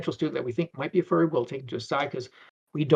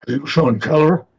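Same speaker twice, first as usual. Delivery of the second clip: loud, slow demand in a put-on voice; huge guy confronting; 9 dB louder, slower than usual. Huge furry approaches him at the con, confronting. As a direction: voice a big stranger slowly calling someone out.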